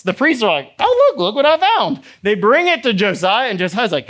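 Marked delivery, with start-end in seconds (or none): 0.8-2.0 high-pitched, excited priest; excited discovery. Acted. Priests bringing their find to Josiah, excited discovery. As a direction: blurt out an astonished find.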